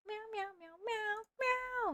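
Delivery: cat meows, high and soft; high meowing. Acted. Streamer meows softly at chat, high cat voice. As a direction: mew like a kitten.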